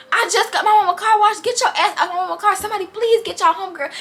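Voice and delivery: high, whiny mock protest; imitated exasperated pleading. Car owner begging friends for help.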